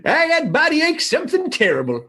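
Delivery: lightly voiced old-timer; a hint of a prospector grumble. In the old-timer podcast bit, a light prospector grumble. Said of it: "did it that way?".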